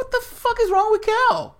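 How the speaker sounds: high, loud disbelieving outburst; incredulous. Retold or imitated. Voicing viewers reacting to the rant, high incredulous cry. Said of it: "and you're like".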